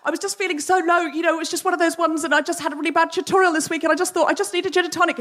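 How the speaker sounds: high, rushed, babbling sorry; flustered apology. Retold or imitated. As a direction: gush out apologies in a panic.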